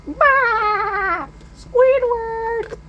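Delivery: very high shrill cartoon call; hammed-up high cartoon yell; SpongeBob yell. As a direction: shout like an excited sponge. SpongeBob yelling.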